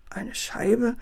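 mildly puzzled, innocent question; feigned surprise. A narrator's reading. Father acting clueless to the barber, feigned surprise. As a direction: play innocent, a touch astonished. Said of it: "tat Herr Clown verwundert".

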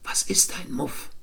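curious little whisper. Acted. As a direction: whisper it so the grown-ups don't hear. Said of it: "flüsterte sofort".

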